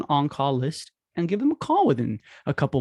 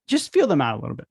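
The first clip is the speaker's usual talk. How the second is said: ordinary talk, nothing marked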